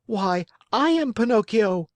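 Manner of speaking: put-on high, eager child voice; excited puppet. Pinocchio reveals himself to the pigeon, high excited voice. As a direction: announce yourself with childlike excitement.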